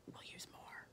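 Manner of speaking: very soft, whispered quote; hushed retold reply. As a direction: drop to a whisper for his answer. Doctor's reply in retold exchange.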